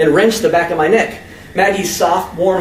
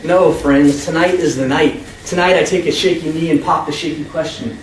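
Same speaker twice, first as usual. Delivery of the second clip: usual speaking voice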